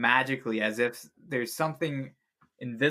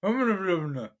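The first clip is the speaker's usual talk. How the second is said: muffled voice; 5 semitones higher than usual. A narrator's reading. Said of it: "you hear a muffled voice go like".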